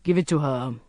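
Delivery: understated command in character; ordering. A narrator's reading. Sheikh to his aide, ordering. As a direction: give a low-key order. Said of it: "ordered the sheikh".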